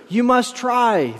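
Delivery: high, slow plea without real sobbing; mild tearful plea. A narrator's reading. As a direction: plead upward, a touch desperate. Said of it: "shouted back in tears".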